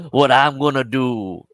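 hammed-up accent, loud, high and slow; exaggerated accent impression, punched loud; Tom Cruise. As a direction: drag out the mocked movie accent. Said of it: "Tom Cruise goes".

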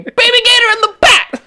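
very high, fast, loud comic scream. Acted. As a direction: scream it like a crazed customer.

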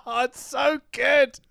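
high, slow gushing; mock gushing fan. Fan gushing about the show, mock high swoon.

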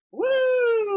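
high, loud whoop; cheering. Real, not acted. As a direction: whoop like at a party.

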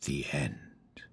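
soft eerie whisper; hushed ghost-story close. A narrator's reading. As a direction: breathe the last words like a secret. Spooky ending of the tale.

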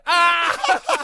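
a high, loud cry of pain; pained yell. Retold or imitated. Friend with pepper in his eyes, a high loud cry. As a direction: shriek as the pepper burns.

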